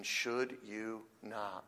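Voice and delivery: SHOULD set apart slowly and softly; softly, deliberately weight the word "should"; stress on SHOULD. Shown as a demonstration. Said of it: "The emphasis here is on the obligation".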